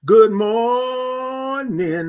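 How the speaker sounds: slow, high stretched "Good morning"; singsong stretched "Good morning"; drawn-out good morning. Acted. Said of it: "drawn greeting".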